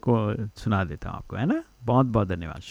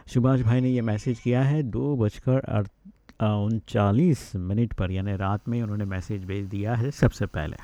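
usual speaking voice

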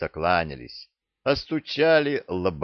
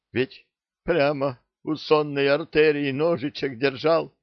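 a hint of a shaken old pirate, higher; stunned pirate; 8 semitones higher than usual. A narrator's reading. Pirate shaken by the near miss, mildly stunned. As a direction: gasp it out, still shaken. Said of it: "потрясённо сказал он".

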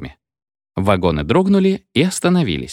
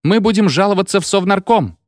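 high-pitched indignant threat; outraged cry; 11 semitones higher than usual. A narrator's reading. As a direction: call out a complaint in protest. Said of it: "кричали в окна вагонов".

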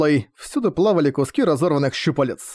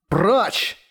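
growled command; 5 semitones higher than usual. A narrator's reading. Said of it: "на ходу прорычал Манхао".

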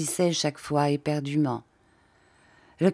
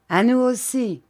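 higher, loud cry; shouted; 7 semitones higher, 4 dB louder than usual. A narrator's reading. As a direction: cry out the toast. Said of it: "cria-t-il".

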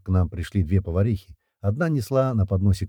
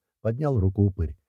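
ordinary delivery, nothing marked

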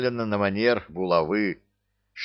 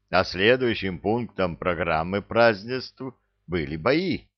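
normal talking voice

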